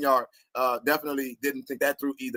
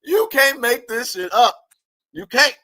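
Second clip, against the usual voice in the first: louder and higher, drawn out slowly; loud disbelief; 8 semitones higher, 7 dB louder, slower than usual. Real, not acted. Reacting to the news for viewers, loud and incredulous. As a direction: belt it out in disbelief.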